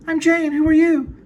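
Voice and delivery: very high falsetto woman voice; Jane's voice. Acted. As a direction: squeak it out high and sweet. I'm Jane.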